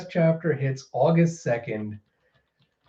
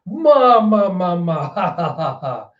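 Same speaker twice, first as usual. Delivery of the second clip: Big Mom cackle; 4 semitones higher than usual. Retold or imitated. Amused pirate enjoying the bloodshed, cackling then fading. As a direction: laugh with relish at the chaos. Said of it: "She goes".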